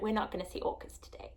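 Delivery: a quick, soft whisper of quiet disappointment. Retold or imitated. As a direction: whisper it to yourself, resigned. Thinking it privately to herself.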